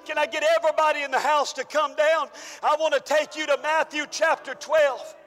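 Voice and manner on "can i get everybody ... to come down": usual speaking voice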